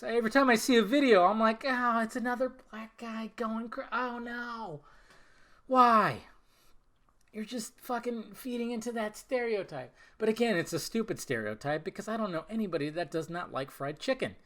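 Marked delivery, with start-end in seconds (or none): 1.7-9.9 mock despair in a high voice, peaking on "why"; dismayed. Host reacts to yet another viral sandwich video, dismayed. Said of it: "It just sucks".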